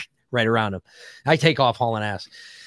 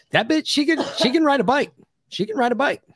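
much higher, impressed praise of her riding; amazed rave; 11 semitones higher than usual. Real, not acted. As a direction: gush about how tough she is. Raving about her riding.

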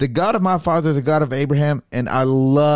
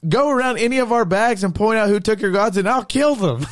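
higher, mock-bold challenge; lightly acted daring vow; defiant Jacob; 7 semitones higher than usual. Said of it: "He's like".

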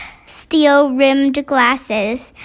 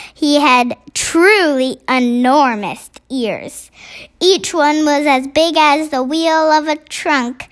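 ordinary delivery, nothing marked

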